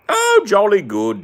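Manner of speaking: high, loud, slow jolly British voice; a hammed-up jolly Brit; mock British accent. Gamer's mock Brit agreeing, in a hammed-up British accent. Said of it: "this British thing is hard".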